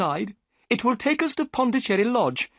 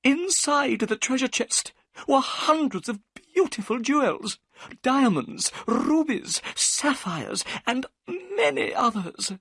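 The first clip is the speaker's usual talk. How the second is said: breathless wonder at the treasure; excited; 12 semitones higher than usual. A narrator's reading. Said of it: "Thaddeus Sholto's voice became excited".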